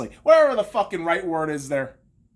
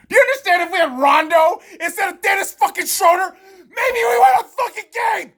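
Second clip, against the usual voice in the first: yelling higher and louder, building to a scream; furious shout; 10 semitones higher, 5 dB louder than usual. Real, not acted. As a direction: blow up at the team's choices. Furious fan rant at team.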